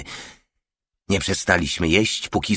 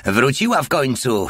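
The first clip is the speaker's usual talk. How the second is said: higher, merry announcement; joyful; 6 semitones higher than usual. A narrator's reading. Porta bringing back the squealing pig, higher and joyful. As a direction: crow it happily, as if amused. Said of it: "śmiał się radośnie".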